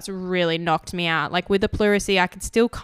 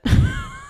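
very high squeal of a laugh; squeaky laugh; 3 dB louder than usual. Real, not acted. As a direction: burst into a squeaky giggle. After slip of the word.

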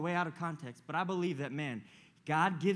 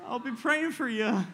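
amused pastor, laughing; 5 semitones higher than usual. Retold or imitated. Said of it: "He's like".

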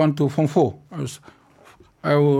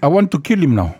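laughing; 4 dB louder than usual. Real, not acted. Joking after being asked to move.

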